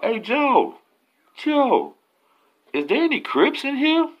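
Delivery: loud, high-pitched holler; yelling down. Retold or imitated. Man yells down to Joe on the bottom tier, hollering. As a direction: call out hard to someone far below. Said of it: "he yelled down to the bottom".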